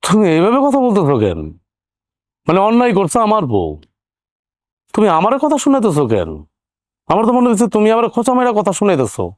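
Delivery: high-pitched hurt objection; aggrieved son pushing back; indignant protest. Acted. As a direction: protest being blamed, each phrase arching up and falling. Son protests at mother's blame.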